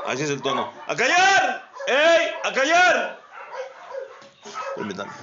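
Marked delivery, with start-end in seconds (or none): 0.9-3.2 shouting. Real, not acted. Shouting at noisy dogs.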